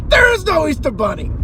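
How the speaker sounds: high, loud put-on tantrum yell; hammed-up raging man; mock furious shout. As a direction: scream it at the kid to hurt him. Quoting the Mallrats line.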